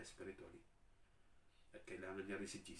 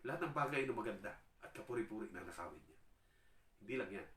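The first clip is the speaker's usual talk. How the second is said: ordinary talk, nothing marked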